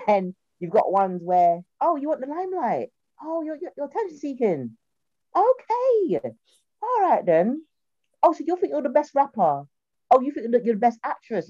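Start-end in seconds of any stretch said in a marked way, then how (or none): 1.8-6.2 high, sarcastic imitation of snide classmates; high taunting mock voice; mocking sneer. 6.8-7.6 high, sarcastic "all right then"; sneering high "all right then"; mock sarcastic. Mocking snide classmates. 8.2-11.5 high, sarcastic imitation of snide classmates; high taunting mock voice; mocking sneer.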